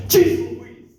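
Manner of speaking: high, breathy "Jeez Louise!"; exasperated outburst. Retold or imitated. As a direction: throw up your hands and blurt it. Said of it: "so exasperated that I would just be like".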